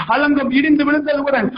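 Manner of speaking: ordinary talk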